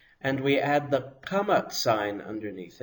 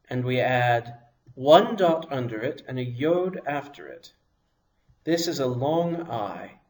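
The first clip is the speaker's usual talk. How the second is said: normal talking voice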